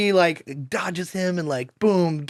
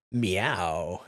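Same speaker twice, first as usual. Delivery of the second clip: Catwoman one-liner; 8 semitones lower, 7 dB quieter than usual. Retold or imitated. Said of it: "zippy one-liner, liners like".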